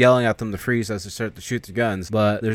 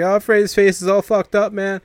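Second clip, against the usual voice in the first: much higher, louder panicked cry; freaking out; 10 semitones higher, 6 dB louder than usual. Acted. Spider panicking over Freddy's face, high loud cry. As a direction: shriek it in panic. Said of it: "Spider's freaking out".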